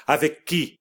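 «avec qui» aigu et pressant; interrogation brutale. A narrator's reading. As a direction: exige la réponse. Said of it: "brutalement elle ajouta". (mère qui harcèle sa fille)

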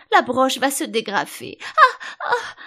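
high wail breaking into loud cries; moaning. A narrator's reading. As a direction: whimper the warning, then cry out. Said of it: "gémit la pauvre reine".

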